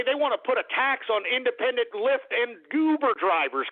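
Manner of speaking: ordinary delivery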